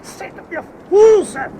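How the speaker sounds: shouting over gunfire; urgent shout. Acted. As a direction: yell it high and slow over the noise. Pulling officer up under fire.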